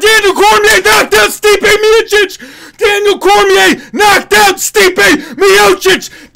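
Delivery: raw, high, loud yelling in disbelief; ecstatic screaming. Real, not acted. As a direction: scream it like your fighter just won the title. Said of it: "that's just the unadulterated response".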